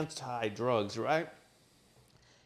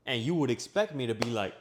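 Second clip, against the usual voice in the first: ordinary delivery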